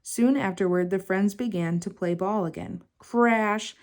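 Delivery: ordinary delivery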